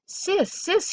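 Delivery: lightly voiced scared small child; frightened little boy. A narrator's reading. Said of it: "I'm frightened".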